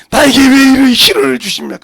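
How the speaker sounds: resentful prayer. Acted. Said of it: "이렇게 기도할 거 아니요?".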